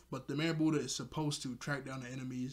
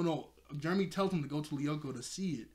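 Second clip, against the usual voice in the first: ordinary delivery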